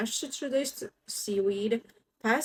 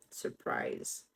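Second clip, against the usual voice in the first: hushed; 8 dB quieter than usual. Acted. Lion jumps out.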